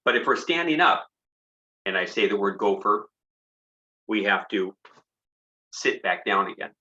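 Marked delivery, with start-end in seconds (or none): none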